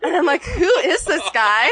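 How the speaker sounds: giggling through the words; laughing. Real, not acted. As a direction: laugh while telling it. Giggling while retelling meeting.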